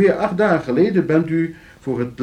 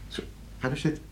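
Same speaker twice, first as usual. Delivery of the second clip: hushed invitation; 3 semitones higher, 11 dB quieter, much faster than usual. Acted. Dringt gast te gaan zitten.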